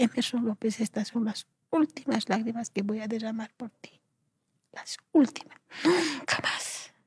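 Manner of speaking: hushed and choked up, drawing out the last words; tearful vow. Retold or imitated. Woman recalling her tearful farewell to her husband, tearful vow. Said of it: "lloré. Y dije".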